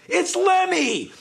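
high drawn-out mock cheer; excited announcement. Telling of [name] boarding the plane, high excited exclamation. Acted reveal of the late passenger.

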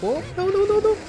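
high, rising "não" in panic; panicked cry. Real, not acted. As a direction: squeal it out as danger hits. Nearly dies in the fight.

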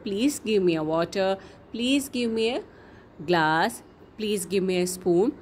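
slow, gentle please demo; slow polite request. Shown as a demonstration. Teacher showing kids how to ask for things, slow and polite. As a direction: spell out each word slowly and politely. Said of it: "तो हम कैसे बोलेंगे?".

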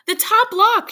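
very high, loud shout up the stairs; loud cry. A narrator's reading. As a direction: call to her husband above. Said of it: "she cried loudly".

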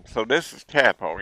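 ordinary talk, nothing marked

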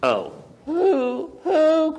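high, plaintive owl hoots; mock-sorrowful high hooting; sad owl. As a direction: hoot it sadly like a rejected owl. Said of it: "cried the owl sadly".

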